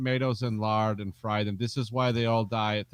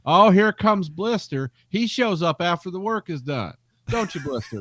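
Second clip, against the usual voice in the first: put-on Southern accent; 7 semitones higher, 5 dB louder than usual. Alabama coworker teasing the guy called Blister, Southern accent. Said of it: "the way they talked".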